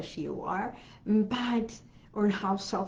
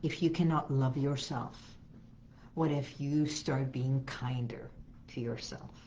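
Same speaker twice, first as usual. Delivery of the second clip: gentle guided affirmation; 4 semitones lower than usual. Acted. Affirmation talk at a mirror.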